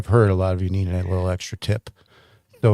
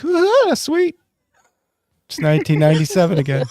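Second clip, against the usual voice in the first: high, squealed fake delight, easing into quicker speech; mock blissed-out cry; 6 semitones higher than usual. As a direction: act out a sudden giddy flashback, very high at first. Acting out reaction to back going out.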